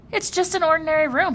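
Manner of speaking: put-on young Jonas. A narrator's reading. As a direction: lift the voice into the boy. Jonas speaks, voiced higher.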